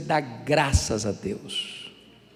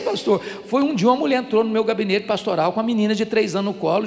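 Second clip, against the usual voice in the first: ordinary talk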